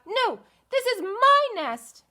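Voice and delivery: high, loud chicken voice, stretched out; squabbling chicken. Acted. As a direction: shove back like a stubborn hen. Chicken claims nest in story.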